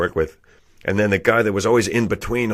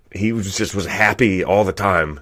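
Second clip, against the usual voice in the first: ordinary talk, nothing marked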